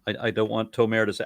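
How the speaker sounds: ordinary delivery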